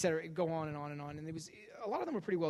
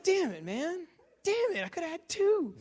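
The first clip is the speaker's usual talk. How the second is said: mock frustration; 12 semitones higher, 5 dB louder than usual. Frustrated outburst.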